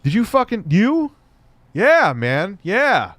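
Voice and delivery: loud, high hype after a fart; boisterous mock triumph; excited yelling. Real, not acted. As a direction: whoop it up like you just scored. Hyping himself after fart.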